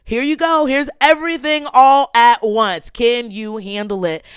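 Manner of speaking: the Lord challenging her. Retold or imitated. The Lord answering her prayer, imitated loud challenge. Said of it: "the Lord has been kind of saying to me".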